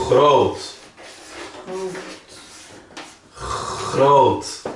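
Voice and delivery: "groot" with a throaty Dutch g from the back of the throat; Dutch guttural g. Shown as a demonstration. Teacher modelling Dutch groot, throaty g. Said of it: "Por exemplo".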